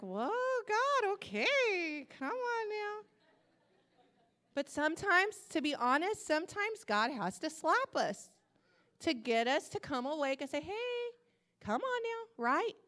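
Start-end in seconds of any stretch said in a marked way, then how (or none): 0.0-3.1 high, drawn-out mock whine; startled protest. Slapped believer gives in to God, high mock protest. 10.6-12.4 high, light, drawn-out nudge; wake-up call. Retold or imitated. God rouses a sleepy believer, high-pitched nudge. God slapping someone awake, imitated.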